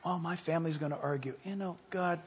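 slow, soft, low thanksgiving; intimate, breath-soft talk to God; hushed prayer. Shown as a demonstration. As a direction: pray almost in a whisper, drawing the words out.

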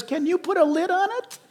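a hint of a laughing, impatient God; amused God. God tells Moses to stop preaching, lightly laughing. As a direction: tell him to hush with a laugh. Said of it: "but God says".

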